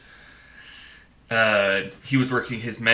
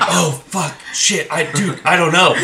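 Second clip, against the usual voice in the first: higher, louder flustered new guy; flustered panic; 6 semitones higher, 7 dB louder than usual. Retold or imitated. New guy caught out at the counter, flustered and louder. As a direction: panic that you don't know, voice up.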